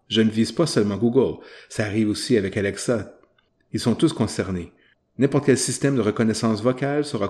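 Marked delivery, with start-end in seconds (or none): none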